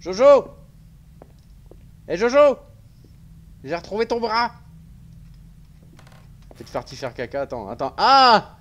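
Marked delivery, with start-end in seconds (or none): 0.1-4.5 loud high call. Pretend. Calling out to character. 8.0-8.6 loud high yelp of surprise; startled cry. Real, not acted. Sudden scare in game.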